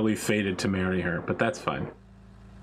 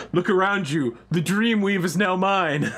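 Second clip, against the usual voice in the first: mock grandiose villain; 8 semitones higher, 4 dB louder than usual. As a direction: gloat a little like a villain. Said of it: "And he's like".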